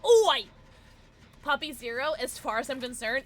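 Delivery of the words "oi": loud, high British yell; British accent. Acted. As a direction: bellow it like a Brit. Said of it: "I still read it like that".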